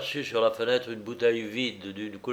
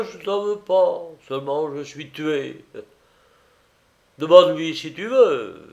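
loud, lamenting tipsy voice; drunken master, self-pitying; 4 semitones higher, 9 dB louder than usual. A narrator's reading. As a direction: accuse her in boozy martyrdom.

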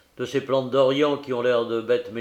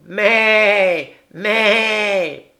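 high, louder quavering bleat; loud goat bleat; 9 semitones higher, 10 dB louder than usual. Acted. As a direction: bleat harder, calling the lion. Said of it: "il bêla plus fort".

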